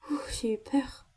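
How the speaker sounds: a whispered sigh of relief after the fright; relieved whisper. Acted. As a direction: breathe it out in a whisper. Relieved after missing horse scare. (chuchoté, soulagé)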